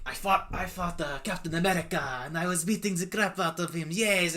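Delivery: exaggerated French accent, boasting. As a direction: play Batroc's proud French boast. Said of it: "Batroc the Leaper was just kinda like".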